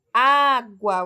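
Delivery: "Água" loud, with a long open first A; vogal aberta. Shown as a demonstration. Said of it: "o som do A é mais aberto". ("Água" com o primeiro A bem aberto e forte)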